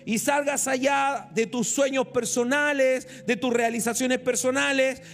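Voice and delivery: pastor urging the church to step into God's boat; preaching appeal. Acted.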